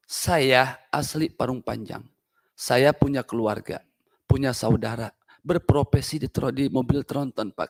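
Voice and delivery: low, slow and soft; quiet, measured, personal admission; subdued confession. Real, not acted. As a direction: drop to a hushed, heartfelt register. Subdued plea about own family.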